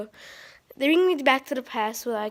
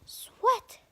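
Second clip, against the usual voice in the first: high whispered bird; 11 semitones higher than usual. Pretend. Bird puppet repeats on request.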